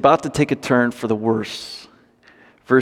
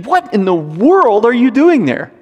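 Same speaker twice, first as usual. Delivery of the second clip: mock shocked question; 8 semitones higher, 3 dB louder than usual. Imagined scolding of missionaries.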